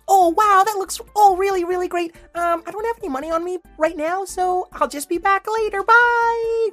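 high, quick little dog voice; chirpy flying schnauzer, pitched high; Patty Pan voice. Acted. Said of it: "said Patty Pan".

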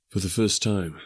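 ordinary delivery, nothing marked

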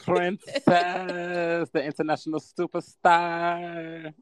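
a grand showbiz introduction; mock announcer. Hyping guest like an announcer.

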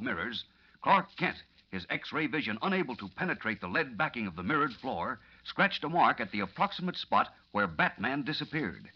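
suspenseful narrator; radio drama narration. A narrator's reading. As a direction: tell it low and tense. Story narration.

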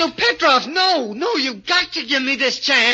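high, loud, desperate protest; desperate cry. Acted. Little man crying out against his guardian, high and loud. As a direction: scream at him not to take it away.

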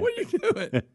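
high-pitched, laughing mock question; acting out disbelief while cracking up. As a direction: squeal it through laughter. Acted reaction to the hang-up, laughing.

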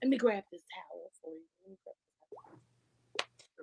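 very quiet, low offer fading to whisper; soft sweet offer. Acted. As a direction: murmur it tenderly, trailing into a whisper. Acts considerate new partner.

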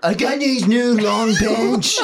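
slow high businessman voice; Trump impression. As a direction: brag about pants like Trump. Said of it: "sounds like Trump".

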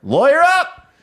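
shouted high and loud. Acted. Comic escalating advice.

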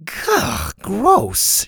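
put-on high-pitched girlish disgust; disgusted gag. A narrator's reading. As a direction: recoil with a gagging "gah". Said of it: "Susie gagged".

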